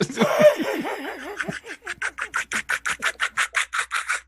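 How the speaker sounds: long, high-pitched creature laugh; drawn-out, high clacking monster laughter; hook horror laugh. Acted. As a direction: cackle like a screeching monster. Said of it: "how would a hook horror laugh".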